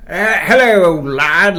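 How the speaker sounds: put-on loud, high, swooping old British gent; rough, overdone British greeting; hammed-up British accent. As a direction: greet someone as a blustery old Englishman. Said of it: "talk in a British accent".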